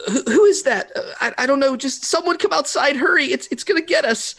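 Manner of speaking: high, frightened stammer; panicked. Acted. Thedor urges them to hurry outside in fear. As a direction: stammer in terror, urging them out.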